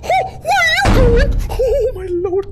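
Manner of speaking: high, loud, slow panic; panicked cry. Real, not acted. Gamer panicking as the toddler wanders off, crying out. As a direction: cry out in alarm.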